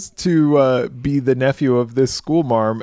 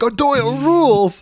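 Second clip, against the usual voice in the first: put-on excited kid shout; 10 semitones higher than usual. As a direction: whoop it like an overexcited kid. Kid cheering.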